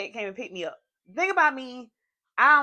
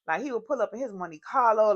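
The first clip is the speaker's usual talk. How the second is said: usual speaking voice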